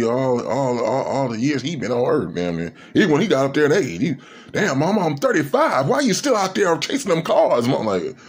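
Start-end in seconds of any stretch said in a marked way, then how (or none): 4.5-7.7 exasperated son. Retold or imitated. Grown son scolding his mother.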